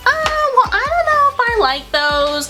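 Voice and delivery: high-pitched hesitant mom voice; imitating doubtful mother. Girlfriend voicing his mom's doubts about suits, high and doubtful.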